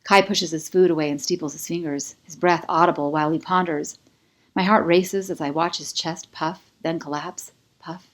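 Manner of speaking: plain reading voice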